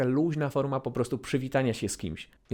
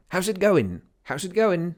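higher, louder fast "How's it going"; quick casual greeting; 6 semitones higher, 4 dB louder, slower than usual. Shown as a demonstration. Teacher demoing the quick casual "How's it going" in a fast breezy way. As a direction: toss it off quickly. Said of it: "taka szybka forma".

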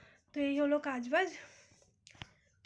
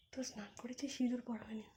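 whisper; 6 dB quieter than usual. Real, not acted. Mother whispering to viewers while the baby sleeps. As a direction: whisper it under your breath. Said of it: "বাবু যেহেতু ঘুমাচ্ছে".

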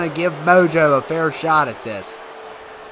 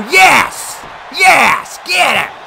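high, loud cheering yells; excited shouting; 11 semitones higher, 5 dB louder, much slower than usual. Real, not acted. Gamer cheering his wrestler on with excited shouts. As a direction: cheer him on at the top of your voice.

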